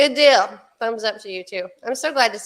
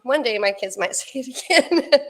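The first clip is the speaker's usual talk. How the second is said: usual speaking voice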